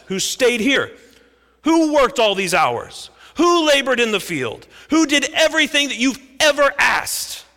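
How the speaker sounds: loud, high, building resentful rant; angry complaint. Acted. Older son ranting at his father about a goat. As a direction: pour out years of grievance. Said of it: "He's angry".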